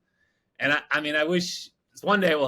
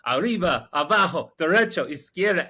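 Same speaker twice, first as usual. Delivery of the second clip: mock shouted directions, raised and louder; imitated yelling out; 4 semitones higher, 4 dB louder than usual. Patty directing the boat in basic Spanish, mock yelling out. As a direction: call out each direction like a drunk lookout. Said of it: "he starts yelling out".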